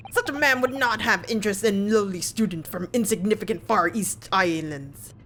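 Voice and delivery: scornful mock Russian sailor, slowing at the end; bad Russian accent. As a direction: sneer in a put-on Russian accent. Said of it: "my very bad Russian attempt".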